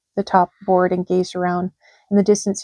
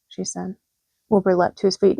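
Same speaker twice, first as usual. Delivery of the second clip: ordinary delivery, nothing marked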